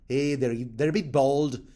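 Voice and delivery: a deliberately bad Irish accent; terrible Irish accent. Joking about mountain clans, in a bad Irish accent. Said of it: "Terrible accent, I know".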